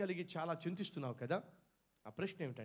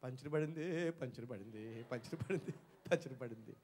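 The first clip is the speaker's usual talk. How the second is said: mock whimpering; 8 semitones lower, 5 dB quieter than usual. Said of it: "ఏడుస్తారా?".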